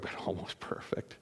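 hushed, barely voiced; whispered aside. Real, not acted. Pastor admiring the bride to the congregation, whispered. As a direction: drop to a whisper on the last words.

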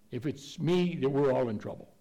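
ordinary talk

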